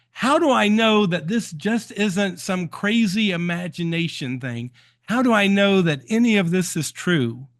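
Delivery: high-pitched mock demand; mock shout. Dreamer demanding proof from her inner self, mock shout. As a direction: call out to the sky, half-hearted yell. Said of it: "she shouted out".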